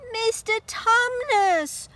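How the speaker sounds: high, slow, loud cry of a name; Lucy's cry. Acted. Lucy sees her friend turned to stone, crying out high. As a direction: call out in shocked dismay. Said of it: "cried Lucy".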